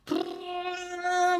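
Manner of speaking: lip-trilled prrr like a toy car; soft imitated engine buzz; toy motor noise. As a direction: buzz your lips like a playing kid. Boy playing with toy.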